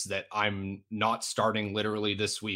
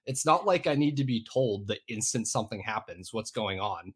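ordinary talk, nothing marked

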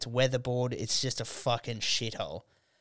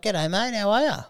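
friendly local; 9 semitones higher, 6 dB louder than usual. Retold or imitated. Imitating an overly friendly local.

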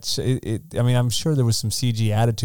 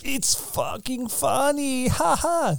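high, slow mocking sneer; snarky; 13 semitones higher, 4 dB quieter, much slower than usual. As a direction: sneer it out slowly with a fake laugh. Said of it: "looks at him snarkily".

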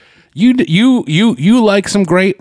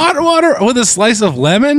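incredulous; 8 semitones higher than usual. Real, not acted. Teasing disbelief at her drink.

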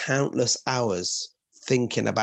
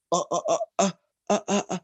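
confused uh-uh-uh stammer; baffled stammer; 5 semitones higher, faster than usual. Retold or imitated. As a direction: stammer like a stumped child. Kids baffled by paradox.

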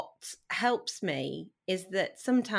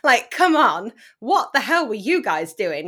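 loud, disbelieving rant; exasperated outburst; 5 semitones higher, 13 dB louder than usual. Real, not acted. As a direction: throw your hands up, loudly. Exasperated at her parents.

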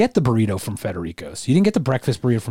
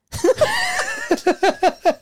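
high giggling burst; burst of laughter. Real, not acted. Breaks out of whispering.

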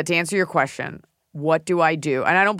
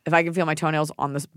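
ordinary talk

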